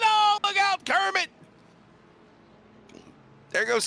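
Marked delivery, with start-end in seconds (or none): 0.0-1.3 sudden high yelp of alarm; excited shout. Real, not acted. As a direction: shout the warning, startled. Commentator exclaims at spin.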